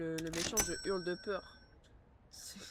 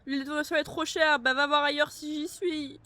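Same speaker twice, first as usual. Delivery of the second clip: sassy retort; 7 semitones higher, 10 dB louder than usual. Pretend. Retorting to in-game customer.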